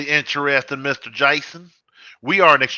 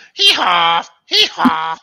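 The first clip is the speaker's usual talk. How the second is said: donkey bray; 4 semitones higher, 6 dB louder, slower than usual. Pretend.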